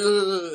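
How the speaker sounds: loud, high imitated cry; mock agonized groan. Mocking man in bad CGI explosion, exaggerated groan.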